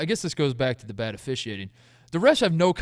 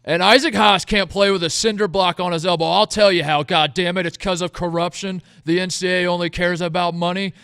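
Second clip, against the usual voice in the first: loud, raised mock rant; hammed-up outraged conspiracy tirade; fans losing their minds; 5 semitones higher, 8 dB louder than usual. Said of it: "Purdue fans losing their minds".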